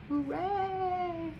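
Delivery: high happy cheer. Real, not acted. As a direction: cheer for him. Reader cheers.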